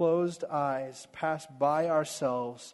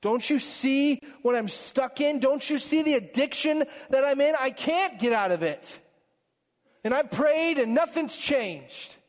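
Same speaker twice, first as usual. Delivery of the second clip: higher, pleading cry to God; desperate prayer; 7 semitones higher than usual. Retold or imitated. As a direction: voice a worn-out believer begging God. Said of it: "being like".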